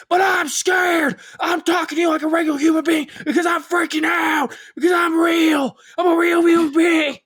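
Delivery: high, loud panicked yell; frantic pundit shout. Retold or imitated. Imitating Alex Jones, high loud panicked yell. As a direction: bellow that you are real. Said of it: "his voice has, like, such a tone".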